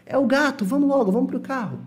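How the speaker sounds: high, hurried call to get going; urgent. Acted. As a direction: push everyone to the car. Acted urgent call to car.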